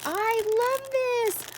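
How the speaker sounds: high, slow squealy gush; gushing over a friend's gift. Real, not acted. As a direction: stretch it out, overjoyed.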